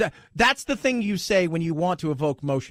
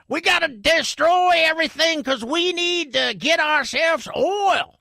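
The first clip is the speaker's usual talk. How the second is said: higher Texan oil man drawl, lightly done; mock Texan; 8 semitones higher than usual. Mocking old oilmen in a light Texan accent. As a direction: play a stodgy Texan oilman. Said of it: "that's what they sound like ... Texan-y".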